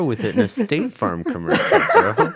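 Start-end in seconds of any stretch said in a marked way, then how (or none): 1.9-2.3 squeaky laughing word. Real, not acted. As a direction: laugh through it.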